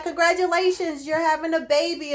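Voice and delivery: high, sing-song congratulations; congratulating clinic lady. Retold or imitated. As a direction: imitate a cheery staffer announcing good news. Clinic lady announces the good news.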